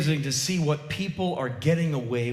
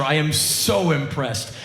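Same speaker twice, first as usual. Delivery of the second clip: usual speaking voice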